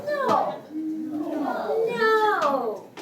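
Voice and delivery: "No" pitched very high, then falling; fourth tone. Shown as a demonstration. Teacher drilling fourth tone, high falling "No". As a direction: launch "No" high and drop it. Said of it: "start it from high pitch and go down".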